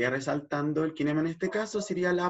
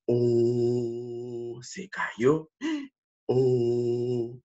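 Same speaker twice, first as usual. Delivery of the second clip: sorpresa exagerada; 3 semitones lower, much slower than usual. Pretend. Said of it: "emoción de la sorpresa".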